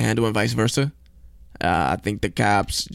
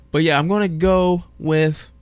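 usual speaking voice

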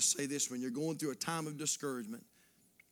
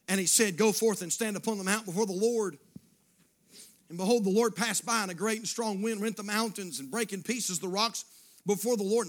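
loud, ringing pulpit reading; forceful scripture reading; 6 semitones higher, 9 dB louder than usual. Acted. Preacher reads Elijah's story to the church, loud proclamation.

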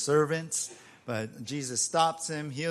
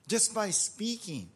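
normal talking voice

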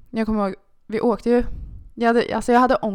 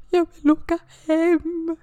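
high, slow whimpering plea; mock crying on the phone to dad; whiny plea; 9 semitones higher, 3 dB louder, slower than usual. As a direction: beg to go home like a crying child. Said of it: "jag bara".

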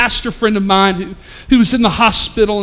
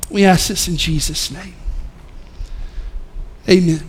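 prayer; 4 semitones lower than usual. Real, not acted. Leads a prayer.

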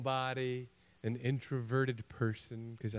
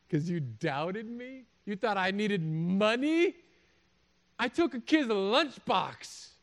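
slow, rising mock disbelief; cocky, stretched-out comic boast; playful gloating; 7 semitones higher, 5 dB louder, much slower than usual. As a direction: draw it out, building to a high, triumphant taunt. Imagined Jesus teasing doubting disciples.

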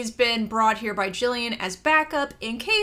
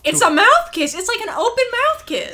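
high, loud and fast; excited; 12 semitones higher, 10 dB louder than usual. Real, not acted. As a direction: blurt it out with glee. Excitedly stressing the kiss.

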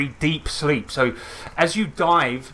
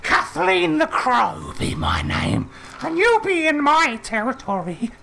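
witch voice; 11 semitones higher than usual. Acted. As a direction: lord it over them like a hag.

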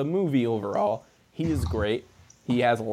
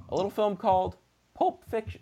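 voice cracking; 7 semitones higher than usual. Real, not acted. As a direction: voice cracks on the title.

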